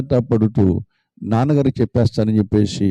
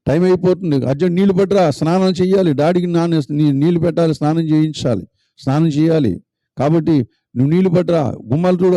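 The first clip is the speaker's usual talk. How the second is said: bossy sister; 6 semitones higher than usual. Acted. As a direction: rush him into the chores. Sister hurries brother with chores.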